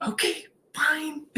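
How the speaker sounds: high, soft mock giving-in; grudging surrender. Giving in to her spiritual team, small high grudging agreement. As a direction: give in reluctantly in a small high voice.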